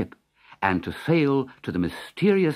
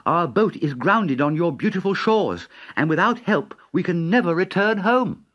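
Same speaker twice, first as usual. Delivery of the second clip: put-on polite boy; 6 semitones higher, 3 dB louder than usual. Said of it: "said Dick".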